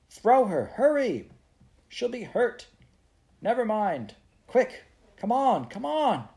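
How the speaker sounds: high, urgent exclamations; frantic shouting. Acted. Girls arguing as burning thatch falls, frantic shouting. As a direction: shout each phrase in panic.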